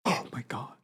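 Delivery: whispered disbelief; hushed, voiceless gasp of awe; shocked whisper. Real, not acted. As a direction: whisper it, stunned. Gasping at the retold line.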